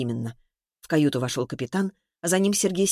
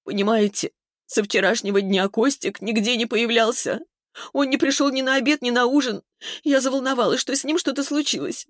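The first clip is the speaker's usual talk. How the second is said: high, tearful explaining; distraught account; 7 semitones higher than usual. A narrator's reading. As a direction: plead to be understood, upset and high-voiced. Distraught Vera explains what happened.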